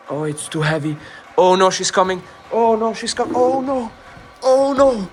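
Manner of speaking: high, loud panic; panicked. Real, not acted. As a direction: scream in panic. Caught by the granny.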